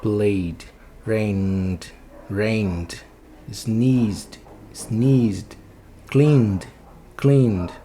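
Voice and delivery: one-syllable "Played", "rained", "sneezed", "cleaned", each ending in voiced D. Shown as a demonstration. Teacher modelling vibrating endings, each verb closed with voiced D. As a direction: drill each word slowly apart, closing on D with no extra vowel. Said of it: "bota o som de D de dado".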